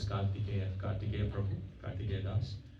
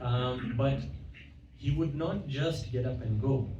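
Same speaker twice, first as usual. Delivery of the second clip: usual speaking voice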